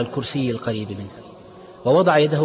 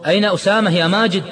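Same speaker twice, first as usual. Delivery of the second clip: repeated question, higher and louder; insistent repeat; 8 semitones higher, 7 dB louder than usual. Acted. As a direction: demand an answer this time. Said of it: "كرر عماد سؤاله مرة أخرى".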